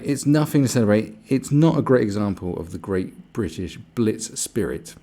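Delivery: loud, emphatic mock outrage; mock indignation. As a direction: read it out as a pompous complaint. Reads the upset post mockingly.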